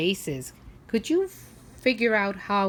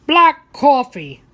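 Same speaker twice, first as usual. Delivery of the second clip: loud, slow curt order; gruff old man; 6 semitones higher, 13 dB louder, slower than usual. A narrator's reading. Old man ordering rudely from the waitress, loud and slow. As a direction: bark the order like a grouch. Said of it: "the old man orders".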